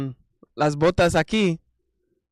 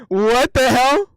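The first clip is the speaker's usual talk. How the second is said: higher, louder, drawn-out "what the hell"; loud disbelief; 8 semitones higher, 7 dB louder, slower than usual. Real, not acted. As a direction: blurt it out half laughing. Bursts out at a funny image.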